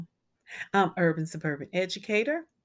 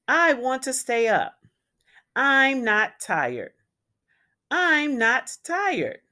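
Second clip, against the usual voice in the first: loud, higher, slow angry protest; angry child; 5 semitones higher, 5 dB louder, slower than usual. Acted. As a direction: stamp out each word like an angry kid at bedtime. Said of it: "He was angry".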